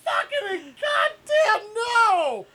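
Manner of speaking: high, slow, hammed-up mock wail; silly clown. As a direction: squeal it out like a clown. Mocking movie line with shouting.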